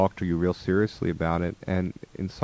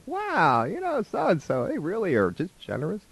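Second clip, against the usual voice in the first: mock admiration; 10 semitones higher than usual.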